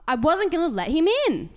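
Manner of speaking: high, loud upset shout; loud high shouted denial at the neighbour. Retold or imitated. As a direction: yell it in frustration. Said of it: "like, shouting".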